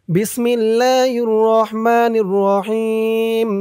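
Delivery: slow, drawn-out melodic recitation; Quranic recitation. Shown as a demonstration. Teacher reciting the dua for viewers, slow drawn-out chant. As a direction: stretch every vowel in a measured chant. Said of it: "আর আমি যেখানে যেমন টান দিব".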